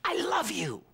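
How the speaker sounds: high shouted squeal of a fan; mock fan scream. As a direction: shriek it like a fan in the crowd. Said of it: "people screaming at the top of their lungs".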